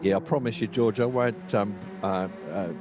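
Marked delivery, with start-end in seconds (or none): none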